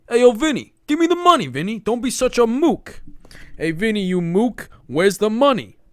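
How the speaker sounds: loud, high mock Italian mobster; hammed-up Italian wiseguy shaking someone down; acting Italian. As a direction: wannabe mob tough guy shouting for his money. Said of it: "I go like this".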